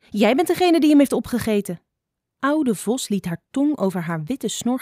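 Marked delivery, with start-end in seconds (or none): 0.1-1.8 higher-pitched indignant retort; accusing storybook bird; indignant accusation. A narrator's reading. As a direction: throw the blame straight back.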